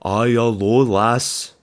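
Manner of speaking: loud, badly faked Scottish accent; attempted Scottish accent. Vlogger who can't do accents trying Scotland, badly faked Scottish accent. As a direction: have a clumsy go at sounding Scottish. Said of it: "what do they say up there in Scotland?".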